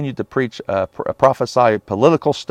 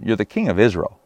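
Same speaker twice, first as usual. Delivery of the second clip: usual speaking voice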